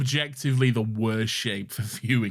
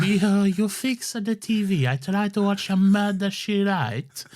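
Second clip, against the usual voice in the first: hammed-up Italian grandma, higher voice; grandma in law greeting Lorenzo, Italian accent; Italian nonna; 7 semitones higher than usual. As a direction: play a doting Italian granny calling his name. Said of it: "an impression of Lawrence's nonna-in-law".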